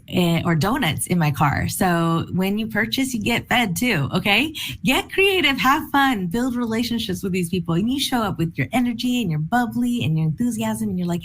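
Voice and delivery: ordinary talk, nothing marked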